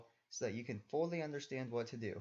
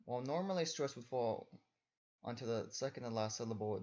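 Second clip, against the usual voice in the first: ordinary delivery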